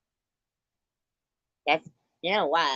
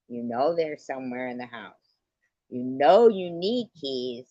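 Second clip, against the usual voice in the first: normal talking voice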